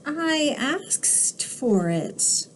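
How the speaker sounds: slow, soft little duckling; lightly voiced duckling. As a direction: innocent small bird answering sweetly. Duckling voice in read-aloud.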